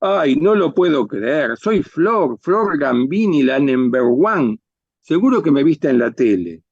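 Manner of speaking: diva efusiva, tono agudo y estirado; diva presumida. Acted. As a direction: saluda como una estrella encantada de sí misma. (diva engreída y teatral)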